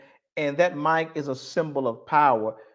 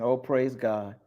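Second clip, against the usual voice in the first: inner voice; 5 semitones lower, 6 dB quieter than usual. Retold or imitated. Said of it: "Her mind is saying".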